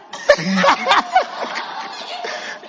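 long high-pitched laugh; real laughter rising high. Real, not acted. Preacher laughs at own joke.

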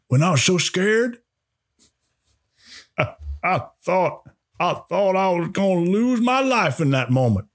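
put-on high, wavering old warden reading his letter. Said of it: "I'll try to do the warden voice".

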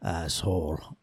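low, quiet movie tough-guy line; Van Damme one-liner. Retold or imitated. Hero after killing the main bad guy, low, quiet one-liner. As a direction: drop it low and soft, deadpan kiss-off. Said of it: "he's like".

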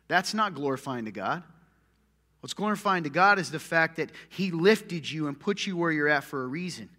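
ordinary talk, nothing marked

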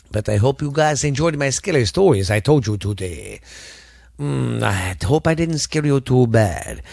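hokey put-on vampire voice; Dracula. Vampire host asking listeners for reviews, mock Dracula. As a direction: play a campy vampire host addressing the audience. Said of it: "trying to do my Dracula voice".

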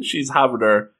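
usual speaking voice

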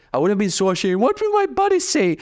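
put-on high, whiny teenage self fretting; squeaky mock-teen worry over the buddies; mock panicked teen. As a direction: play your embarrassed teenage self, voice jumping high.

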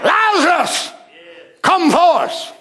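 commanding pulpit shout; loud cry. Acted. Preacher as Jesus calling Lazarus from the grave, loud cry. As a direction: call it out as if to wake the dead. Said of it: "He cried with a loud voice".

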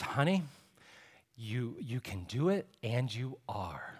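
soft, slow, soothing encouragement; tender reassurance. Retold or imitated. Doula soothing the mother in labor, soft and tender. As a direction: coax her gently like stroking her hair. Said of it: "caressing Lauren's hair".

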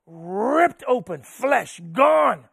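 drawn-out, forceful RIPPED OPEN; sudden preacher's outburst. Real, not acted. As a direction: burst out of the hush, punching each word. Climax of whipping story, sudden outburst.

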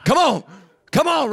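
loud rising cheers of come on; building loud cheer. Retold or imitated. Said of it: "The ovaries like".

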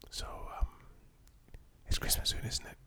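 hushed, breathy scary whisper; spooky whisper. Acted. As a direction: whisper it like a creepy ghost. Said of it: "Why do you sound so scary?".

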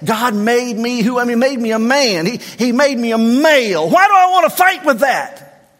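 preaching build. Acted. Pastor to the church, building to a loud high cry.

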